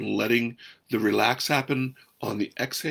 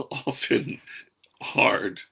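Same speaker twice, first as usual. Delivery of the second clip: ordinary delivery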